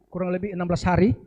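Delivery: normal talking voice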